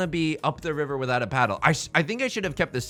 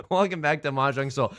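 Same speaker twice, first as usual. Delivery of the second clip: normal talking voice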